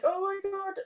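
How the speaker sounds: high, panicked gasp of a friend; mock-panicked, high and fast; panicking friend. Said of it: "he's panicking".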